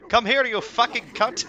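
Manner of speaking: loud, high furious yell; mock yell. Raid leader yelling at the dragon, loud yell. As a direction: scream it at the dragon. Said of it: "he just yells at him".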